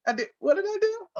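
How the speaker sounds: high, fast, baffled "what did I do"; confused disbelief. Retold or imitated. Retelling to the host, high and baffled. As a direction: squeak it out like you truly have no idea. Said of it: "I'm like".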